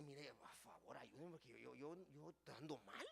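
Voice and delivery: soft, pleading cry for help; humble plea. Acted. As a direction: beg quietly, ashamed. Sinner begging others for help. (súplica humilde y suave)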